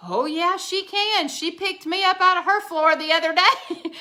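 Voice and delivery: put-on proud old mama backing her up, giggling; laughing granny. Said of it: "my mama goes".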